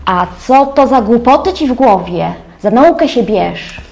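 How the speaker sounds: mock a dismissive parent brushing off a child's question; imitating a reproachful parent, drawn out at the end; scolding parent. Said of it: "odpowiadanie w ten sposób".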